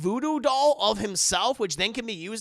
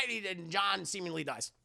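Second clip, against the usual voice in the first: ordinary talk, nothing marked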